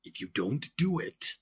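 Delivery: gravelly-free dramatic trailer read; movie announcer voice. Acted. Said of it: "talking in my movie announcer voice".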